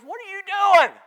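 high, loud startled dad; dad's sharp question. Retold or imitated. Father catching his son wrecking the bike, loud and high. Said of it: "and he goes".

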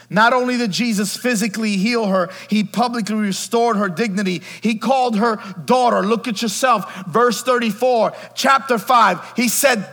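loud, high preaching build; full-force preaching. Acted. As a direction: lift the congregation up, loud.

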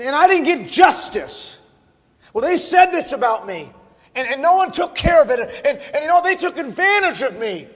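high, loud, indignant complaint; hammed-up wounded outrage; aggrieved protest. Acts out an aggrieved self-pitying complainer.

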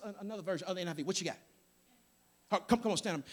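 normal talking voice

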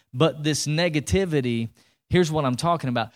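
usual speaking voice

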